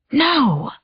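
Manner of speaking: high, breathy shocked no; shocked gasp. Acted. Seeing the photograph, horrified. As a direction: recoil in horror. Sees the transparent image.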